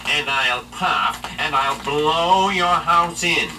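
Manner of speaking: slow, high, loud wolf threat; big bad wolf. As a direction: menace the pig, drawn out. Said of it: "Then said the wolf".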